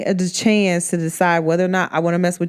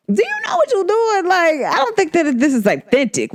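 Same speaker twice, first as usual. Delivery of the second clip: very high "do you know what you're doing?"; high, disbelieving confrontation of a friend; incredulous challenge; 11 semitones higher than usual. Retold or imitated. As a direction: call her out in disbelief. Confronting friend about love spell.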